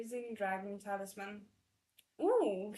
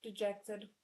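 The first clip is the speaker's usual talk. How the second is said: ordinary delivery, nothing marked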